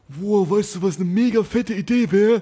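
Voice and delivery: high, wide-eyed sudden idea; excited stoner. Retold or imitated. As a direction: gush over a huge idea. Stoned person gets an idea.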